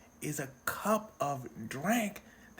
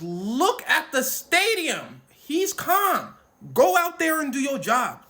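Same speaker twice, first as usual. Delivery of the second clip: loud, slow, higher scolding; scolding command; 8 semitones higher, 8 dB louder than usual. Retold or imitated. Reggie Miller ordering the officer, loud scolding. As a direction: tell him off in front of everyone.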